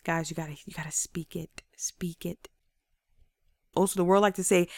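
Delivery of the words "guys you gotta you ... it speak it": low, near-whispered plea; hushed urging. Real, not acted. Speaker urging listeners to speak it, hushed intensity. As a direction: urge them under your breath.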